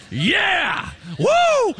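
high, loud whoop; playful cheering. Pretend. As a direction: whoop in mock triumph. Celebrating own name in the verse.

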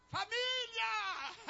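hammed-up, drawn-out joyful greeting; cheerful homecoming call. Acted overly cheerful homecoming.

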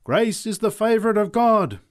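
high, loud proclamation; ringing sermon line; preaching. Acted. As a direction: proclaim it to the street.

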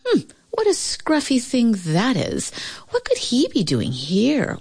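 high-pitched snooty dog looking down her nose; disdainful wondering. A narrator's reading. Pasha eyes the scruffy newcomer, disdainfully. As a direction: wonder aloud with lofty disdain. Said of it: "wondered Pasha, looking down her long Russian nose".

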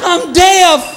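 hammed-up deaf yell; mock deaf shout. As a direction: yell like you've gone deaf. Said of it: "You're like".

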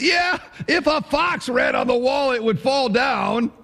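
eager mock jeer. Tobiah piling on Sanballat's mockery, high gleeful jeer. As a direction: jump in with a cheap joke. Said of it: "and he goes".

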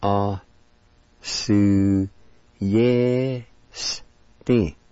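"A su yes ti" in long separate syllables. Shown as a demonstration. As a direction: sound out "A... su... yes... ti" with pauses. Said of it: "And it's pronounced like this".

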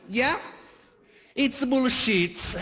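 put-on slow, high Italian-accented dismissal; Italian father accent. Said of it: "said it like my Italian father".

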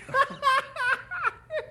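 high, long cackle; helpless high giggling; old-timer's cackle. Acted. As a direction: crow with laughter at your own joke. Laughs at own joke.

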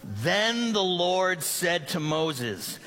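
slow, unison recitation; reading together aloud. Shown as a demonstration. Church reads the verse aloud together, slow and unison. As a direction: recite it slowly with everyone. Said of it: "let's say this verse out loud together".

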